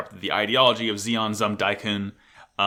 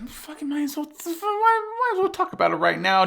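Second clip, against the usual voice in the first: much higher silly falsetto; high goofy voice. Acted. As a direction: squeak it in a cartoon falsetto.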